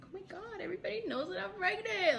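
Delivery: high, giddy gush; happy amazement. Retold or imitated. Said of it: "I was so happy".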